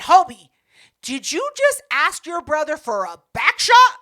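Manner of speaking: loud retold "did you really just say that"; incredulous callout. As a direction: call him out loudly in disbelief. Calling out friend's slip.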